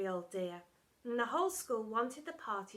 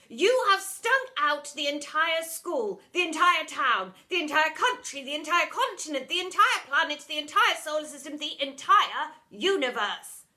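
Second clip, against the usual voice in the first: building rant; 4 semitones higher, 10 dB louder than usual. Acted. Miss Seeth scolding pupil over egg sandwich, ranting. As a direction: pile each place on bigger.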